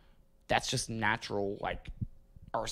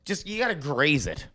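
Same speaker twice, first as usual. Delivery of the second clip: exasperated outburst; 8 semitones higher, 6 dB louder than usual. Retold or imitated. Exasperated quote at virgin partner.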